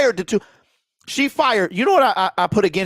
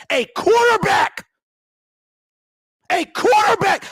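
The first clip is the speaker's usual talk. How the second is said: furious shout; 8 semitones higher, slower than usual. Real, not acted. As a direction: explode on quarterback, stretching it out. Builds to a yell on repeat.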